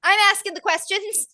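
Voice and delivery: put-on high-pitched Irish girl taking charge; Irish accent. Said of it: "heavy Irish accent".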